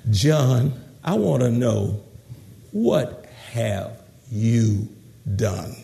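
low, slow, tender question; gentle and kind. Acted. Counselor asks John what he has done, gentle and kind. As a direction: ease down softly, leaving space between words. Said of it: "look at 'em with gentleness and kindness".

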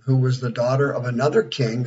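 ordinary talk, nothing marked

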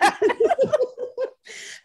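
very high cackling laugh; very high cackle. Real, not acted. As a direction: burst out laughing. Laughs at the catchphrase.

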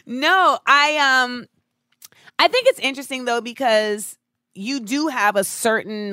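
ordinary talk

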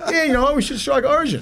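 high, mocking, tongue-in-cheek sarcasm; taking the piss. Ex-player showing the press how he joked, high mocking voice. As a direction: toss it off as a joke, pitched well up. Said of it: "I was taking the piss".

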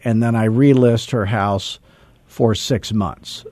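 normal talking voice

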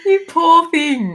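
gasp, then slow, high sympathy. Real, not acted. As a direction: draw it out with a gasp of pity. Sympathy after scary story.

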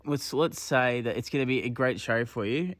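ordinary talk, nothing marked

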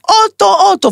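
put-on high-pitched, childish screech of demand; mock demanding shout. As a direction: act out a spoiled kid's yelling. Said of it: "צועק". (צעקה ילדותית גבוהה, בחיקוי)

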